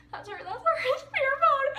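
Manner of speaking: high, squealing frantic urgency; near-tears excitement. Retold or imitated. Spotting Gabby, begging Sierra for the phone, frantic. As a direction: re-enact a frantic fangirl on the verge of tears. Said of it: "I almost started crying".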